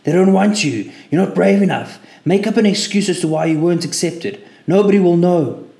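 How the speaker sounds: loud, high screaming doubt; panicked shouting inner voice. Acted. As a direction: let the inner voice scream him down. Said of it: "the voice was now screaming".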